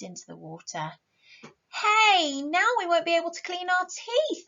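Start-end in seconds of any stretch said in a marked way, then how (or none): 1.7-4.4 high, louder cry of protest. Acted. Ellen protests to Mirabell about the toothpaste, loudly. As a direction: object loudly as the toothpaste goes in. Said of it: "Ellen protested".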